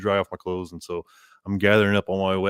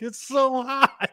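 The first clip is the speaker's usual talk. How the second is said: high, drawn-out mock wail; mock agony; much slower than usual. Scalded man answering the woman, mock wail. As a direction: stretch it out in a high pretend whine. Acting his scalded reply.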